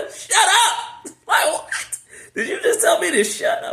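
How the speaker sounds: high, loud, laughing mock outrage; laughing disbelief. Real, not acted. Laughing at the story.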